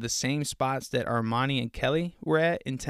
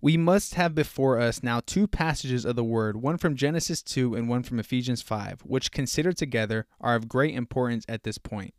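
ordinary talk

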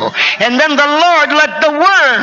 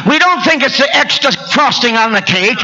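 ordinary talk, nothing marked

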